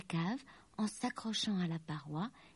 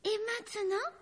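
high-pitched storybook lady; high, inviting character voice; dragon aunt hostess; 12 semitones higher than usual. A narrator's reading. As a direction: address the little workers in a high voice. Lucie addresses the elves.

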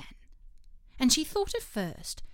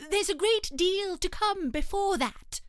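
fussy rabbit; 10 semitones higher, 5 dB louder than usual. Acted.

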